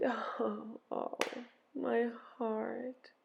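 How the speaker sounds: soft, breath-filled sigh. A narrator's reading. Ereshkigal sighing over her heart, soft pained sigh. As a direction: let the words out on a hushed sigh. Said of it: "She sighed".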